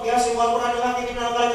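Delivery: loud monotone rattled-off Quran recital; demonstrating careless speed-chanting; hasty recitation. Shown as a demonstration. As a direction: rush through Yasin on one note. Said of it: "Baca Yasin pun jangan cepat-cepat".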